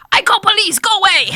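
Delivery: high, loud panicked refusal; alarmed laundromat reply. Retold or imitated. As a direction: shout it in a high voice, imitating a rattled stranger on the phone. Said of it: "And the response was".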